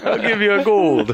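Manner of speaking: high, loud Brooklyn guy voice; hammy Brooklyn street hustler; brooklyn accent. Acted. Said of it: "It's like Brooklyn guy".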